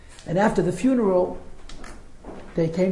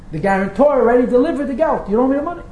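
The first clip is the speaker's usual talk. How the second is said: lively insistence; 6 semitones higher, 4 dB louder than usual. Retold or imitated. Lender insists debt is paid.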